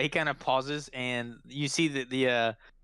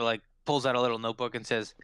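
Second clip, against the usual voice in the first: ordinary delivery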